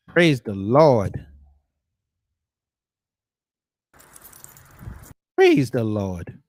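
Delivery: slow, loud preacher-style praise; churchy praise. Pretend. Streamer joking in church style, slow and loud. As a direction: stretch every word like a deacon.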